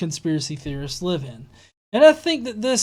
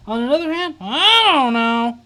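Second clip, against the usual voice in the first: mocking imitation; 5 semitones higher, 5 dB louder than usual. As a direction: mimic her shrugging it off. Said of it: "on the one hand".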